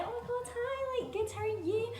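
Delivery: high, soft gushing hello; imitated delighted star greeting; excited greeting. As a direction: greet fans in a high, airy flutter. Acted star's greeting to fans.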